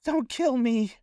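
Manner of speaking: high, pleading cry of a terrified woman; crying plea. Acted. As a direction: beg for your life, voice pitched high. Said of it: "She's just crying really loudly".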